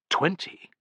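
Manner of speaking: high-pitched, startled inner question; suppressed alarm; alarmed thought. A narrator's reading. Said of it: "thought Tullus in alarm".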